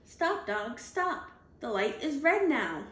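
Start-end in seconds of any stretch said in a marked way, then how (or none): none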